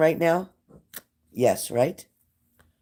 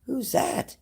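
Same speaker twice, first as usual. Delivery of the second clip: much higher, retold startled question; 10 semitones higher, 4 dB quieter, much slower than usual. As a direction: replay the jolt of hearing a stranger. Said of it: "I'm like".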